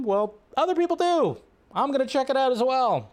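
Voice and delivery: high mocking comeback voice; mock reply. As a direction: answer back in a silly high voice.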